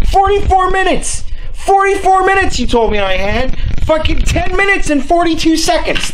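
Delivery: high, incredulous scolding; real exasperation at a dead battery; indignant complaint. Real, not acted. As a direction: throw the broken promise back at it, pitched high.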